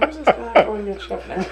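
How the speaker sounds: high, laughing disbelief. Real, not acted. Recalling the TV host's wrong name, laughing aloud. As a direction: crack up while asking it. Retelling live TV mixup, laughing.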